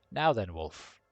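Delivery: icy formal greeting, measured; the cold patriarch receives his guest; cold aristocrat. Acted. Host greets Wolf coolly as cold aristocrat. GM redoes Telestrian line.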